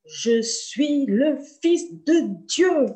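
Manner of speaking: slow, loud, booming divine proclamation; voice like thunder. Retold or imitated. The Son of God announcing himself to her, slow and thunderous. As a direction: rumble it out like rolling thunder. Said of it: "une voix qui est comme le tonnerre qui vient et qui gronde".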